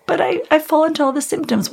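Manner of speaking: high, insistent imitation of herself objecting; retold protest. Patient objecting to doctors who don't listen, high retold protest.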